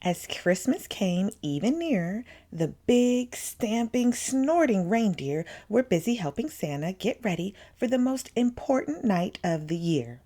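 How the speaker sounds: usual speaking voice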